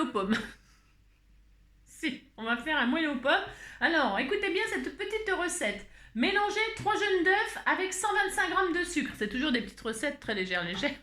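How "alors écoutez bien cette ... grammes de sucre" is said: higher, presenter-like recipe reading; changed tone. Pretend. Host dictating an apple cake recipe to viewers, in a lifted presenter tone. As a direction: announce the recipe in a lifted, sing-song voice. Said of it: "Allez, on va changer de ton".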